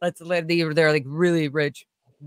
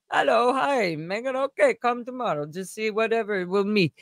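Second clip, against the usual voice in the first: put-on higher, softer Indian-accented caller; bad Indian accent; 4 semitones higher, 4 dB quieter than usual. House manager calling about a job, bad Indian accent. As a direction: imitate a sweet Indian man on the phone. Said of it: "I'm doing the worst accent".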